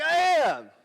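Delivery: high, loud shouted retort; yelling back. Retold or imitated. Driver answering his panicking commander, yelling back. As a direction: holler it back over the panic.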